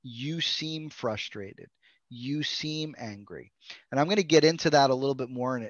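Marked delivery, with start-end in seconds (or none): 0.0-3.5 soft, slow reflective statement; gentle tentative statement. Shown as a demonstration. Therapist modeling a reflection to a child, soft and slow. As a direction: say it softly and slowly, like naming a feeling for a child. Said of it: "We're saying".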